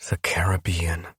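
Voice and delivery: whispered, barely voiced; soft whisper. A narrator's reading. She names her secret destination in a soft whisper. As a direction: breathe it out softly. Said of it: "Her voice is soft".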